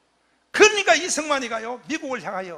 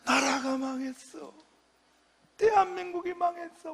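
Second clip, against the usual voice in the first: mock weeping lament; 5 semitones higher, 4 dB quieter than usual. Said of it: "대답도 안 하고 울어".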